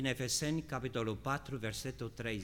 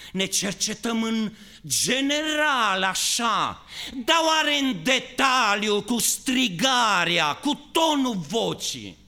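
high, loud shouted preaching; preaching at full force; 11 semitones higher, 13 dB louder than usual. Acted. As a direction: call it out over the church. Predică în forță. (predică strigată, tare și sus)

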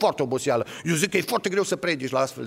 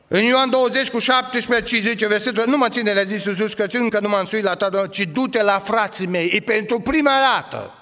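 loud, higher scripture proclamation; forceful preaching; 8 semitones higher, 5 dB louder than usual. Acted. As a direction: proclaim the verse to the congregation.

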